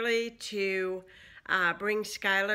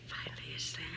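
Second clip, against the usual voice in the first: soft whisper, barely voiced; whispering over a crib; 6 semitones lower, 11 dB quieter, faster than usual. Real, not acted. Baby just fell asleep.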